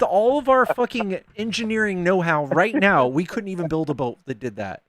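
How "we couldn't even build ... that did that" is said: ordinary delivery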